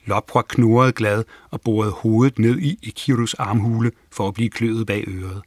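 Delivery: usual speaking voice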